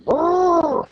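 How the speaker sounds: high drawn-out roar; imitated bull roar. Explaining the brazen bull's screams, high imitated roar. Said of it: "the screams sound like the, uh, bull".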